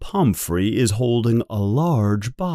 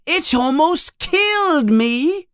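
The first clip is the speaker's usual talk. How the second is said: can't believe it; shocked; 11 semitones higher than usual. A narrator's reading. Harry shocked.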